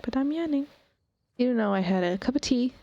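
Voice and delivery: usual speaking voice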